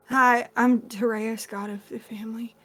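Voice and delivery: slow start trailing off very soft; sheepish, caught out. Acted. God introduces himself to angry aunt, sheepish mumble. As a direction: introduce yourself like a kid in trouble. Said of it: "like a kid that's just been caught".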